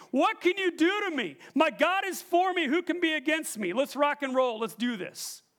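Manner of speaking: high, loud battle challenge; David's rallying cry, easing down at the end; bold defiance. Acted. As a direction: preach it as a fearless dare, then settle. Acts David defying the Philistine.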